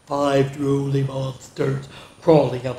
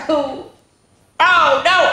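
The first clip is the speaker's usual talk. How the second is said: scared oh no; 12 semitones higher, 5 dB louder, slower than usual. Acted. Fright in story.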